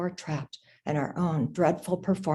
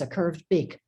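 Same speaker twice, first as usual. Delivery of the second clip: ordinary delivery, nothing marked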